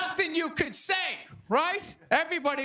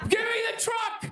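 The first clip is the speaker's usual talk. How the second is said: panicked pleading; 8 semitones higher than usual. Retold or imitated. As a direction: beg to be let off the job in a panic. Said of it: "on the ground crying in the fetal position".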